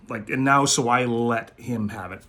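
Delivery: normal talking voice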